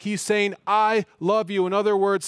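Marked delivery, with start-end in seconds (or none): 0.7-1.7 "I" punched loud and drawn out; slow down and hit "I" hard; stress on I. Shown as a demonstration. Preacher voicing Christ's declaration of love, emphatic "I". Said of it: "The I is emphatic".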